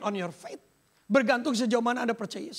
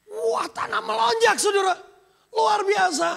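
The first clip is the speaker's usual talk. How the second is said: amazed; 9 semitones higher, 5 dB louder than usual. Real, not acted. Amazed at land price rise.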